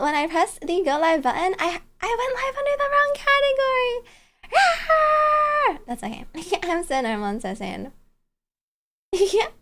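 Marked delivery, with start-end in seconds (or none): 4.4-5.8 high laughing shriek at her own mistake; embarrassed shriek. Real, not acted. Streamer shrieking after going live wrong. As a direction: squeal it out, mortified.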